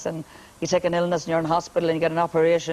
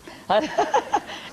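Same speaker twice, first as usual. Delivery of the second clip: breathy high laugh; 8 semitones higher than usual. Real, not acted. Laughs at joke.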